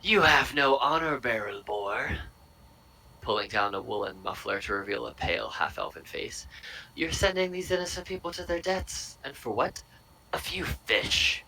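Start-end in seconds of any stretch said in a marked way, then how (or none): 0.0-2.2 loud, high accusing half-elf; half-elf scolding. Acted. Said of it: "and he goes". 6.9-11.4 half-elf accusing. Acted. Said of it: "and he goes".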